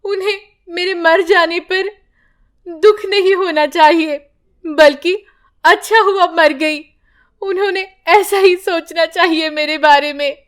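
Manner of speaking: tearful confession to God; sad. Acted. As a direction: speak through sorrow. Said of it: "Padma दुखी होकर कहती है".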